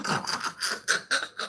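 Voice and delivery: low-level, breathy voiceless chuckle; laugh. Real, not acted. Laughing after vowing to cry again, soft chuckle. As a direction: let a soft laugh trickle out.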